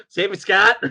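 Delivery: loud, high, laughing cry for help; mock plea. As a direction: imitate a damsel's plea while laughing. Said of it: "she's just like".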